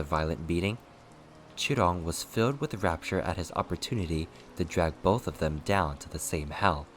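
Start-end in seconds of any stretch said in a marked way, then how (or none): none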